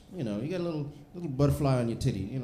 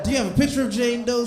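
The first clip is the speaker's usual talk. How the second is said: higher, louder, fast grilling; suspicious cop; 9 semitones higher, 6 dB louder, faster than usual. Retold or imitated. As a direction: press him like a suspicious detective.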